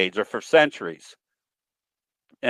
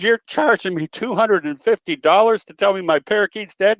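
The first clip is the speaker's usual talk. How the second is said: higher, mildly outraged lady voice; indignant lady; 6 semitones higher than usual. Retold or imitated. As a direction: protest the bill as the lady, pitched up. Joke: lady shocked at bill.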